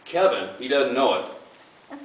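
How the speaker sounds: ordinary talk, nothing marked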